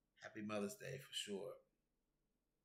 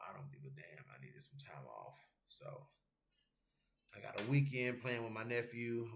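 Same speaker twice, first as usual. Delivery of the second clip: ordinary delivery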